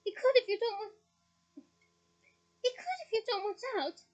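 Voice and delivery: high, loud angry warning; angry Hermione. Acted. Hermione angrily warning the twins. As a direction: snap the warning at them. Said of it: "said Hermione angrily".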